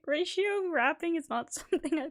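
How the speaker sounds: high-pitched, breaking into laughs mid-sentence; helplessly amused, giggles interrupting the words; laughing to tears. Real, not acted. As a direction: talk through real laughter. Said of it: "I'm laughing and crying".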